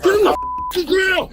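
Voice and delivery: loud, high, slow furious order; angry shout. Real, not acted. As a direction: roar the command at him. Deputy forcing suspect down.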